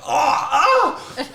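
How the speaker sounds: high-pitched mock yelp of pain; pained cry. Gulped vodka thinking it was water, pained yelp. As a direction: cry out as if the swallow burns. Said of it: "It was painful".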